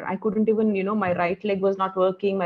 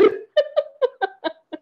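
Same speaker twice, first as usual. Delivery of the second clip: a sudden high laugh that breaks out; burst of laughter. Real, not acted. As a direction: laugh at yourself, brightly. Laughing at her own hair-washing story. (real laugh at her own story)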